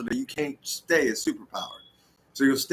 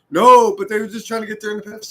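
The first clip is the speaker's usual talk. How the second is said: high, loud, whiny mocking voice; mock whiny objector; 8 semitones higher, 8 dB louder than usual. Comedian mocking people defending Ukraine, whiny and loud. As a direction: sneer it like a smug debater.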